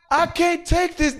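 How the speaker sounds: high, strained cry; hammed-up girl's high cry; mock woman crying out. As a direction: squeal it out like she's overwhelmed. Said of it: "she's yelling out".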